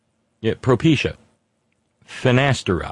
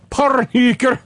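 "Porn hooker" higher with a clipped "hooker" in a put-on Eastern European accent; mock Balkan accent; 9 semitones higher than usual. Host amusing himself with the accent, playful mock Balkan accent. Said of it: "Eastern European Balkan accent".

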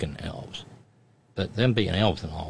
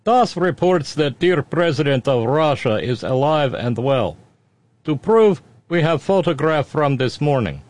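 hammed-up Russian accent, higher and louder; 7 semitones higher, 6 dB louder than usual. Radio host reading a listener's joke, thick Russian accent. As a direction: read it like a proud Russian state announcer. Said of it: "Read this with Russian accent".

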